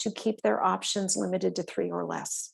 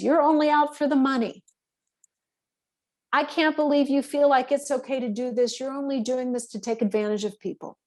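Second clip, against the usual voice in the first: imitating angry client; 4 semitones higher than usual. Vet imitates an angry client accusing her, in a mock raised voice. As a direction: play the upset pet owner, lightly hammed. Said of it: "when someone says".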